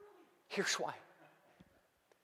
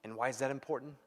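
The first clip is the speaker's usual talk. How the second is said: ordinary delivery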